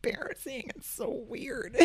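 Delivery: high, hushed retold complaint; imitated embarrassed kid, under the breath; cringing embarrassment. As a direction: complain softly and high, mock-mortified. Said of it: "kind of cringe a little".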